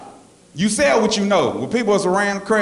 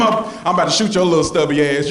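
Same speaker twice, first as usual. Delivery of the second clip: ordinary talk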